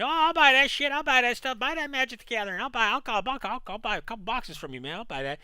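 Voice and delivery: high, eager customer impression; mock customer voice. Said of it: "come in and tell me".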